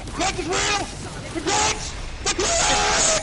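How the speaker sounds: high, rising excited shout; excited cheer. Real, not acted. Excited cheer after win.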